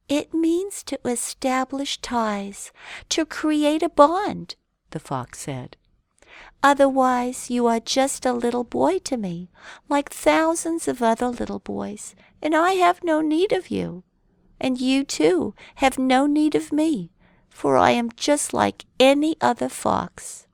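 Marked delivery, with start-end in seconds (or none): 0.1-4.5 higher, slow gentle fox voice. A narrator's reading. Fox explains. 6.6-20.5 higher fox voice. A narrator's reading. Fox explains.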